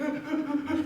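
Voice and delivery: high, hammed-up gasping sob with a laugh; mock sobbing gasp. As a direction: gasp like you're crying on the table. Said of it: "so I'm crying and dry heaving".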